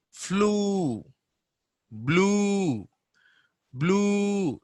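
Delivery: flu and blue with a high, held oo; long oo. Shown as a demonstration. Teacher drills long oo words, high and held. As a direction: hold each oo long and high. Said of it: "you can bit expand it".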